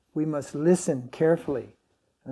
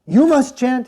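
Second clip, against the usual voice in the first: retold angry order, much higher and louder; angry command; 9 semitones higher, 12 dB louder than usual. Guru angrily ordering devotees to chant instead of recording, loud scolding. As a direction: scold them into chanting, sharp and forceful. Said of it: "like, angry".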